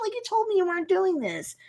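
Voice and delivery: high, quick mock protest; accusing. Jen confronting Emil over transforming, accusing.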